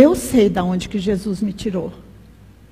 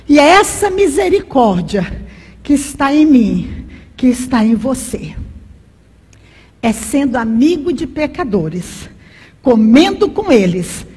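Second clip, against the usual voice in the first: normal talking voice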